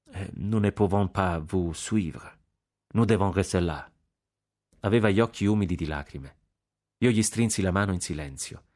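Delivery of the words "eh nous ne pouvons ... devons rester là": ordinary delivery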